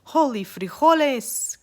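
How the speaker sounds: high, loud, slow battle cry. Acted. Scapito crying out as he thrusts his sword, high and loud. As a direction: shout it as you thrust the sword. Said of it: "cried Scapito".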